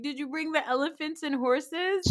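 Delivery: put-on high-pitched eager kid question; little boy. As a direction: imitate a small child asking. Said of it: "then little Arya shows up".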